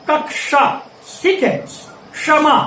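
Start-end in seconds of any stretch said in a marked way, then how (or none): 0.1-0.7 कक्षा said as "kak-sha"; कक्षा with sh, not chh; correct pronunciation. Shown as a demonstration. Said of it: "श के रूप में करते हैं". 2.1-2.5 क्षमा said as "kshama"; क्षमा with sh, not chh; correct pronunciation. Shown as a demonstration. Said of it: "इस तरह से हम इसका उच्चारण करते हैं".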